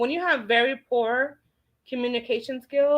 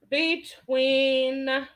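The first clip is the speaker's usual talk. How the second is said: ordinary delivery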